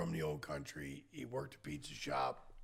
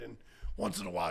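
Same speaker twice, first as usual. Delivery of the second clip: ordinary talk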